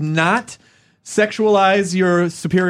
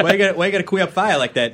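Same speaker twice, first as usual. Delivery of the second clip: quick mock Boston heckle; Boston accent; 3 semitones higher, faster than usual. Schoolmate needling someone over a word, fast Boston accent. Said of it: "insult people with a Boston accent".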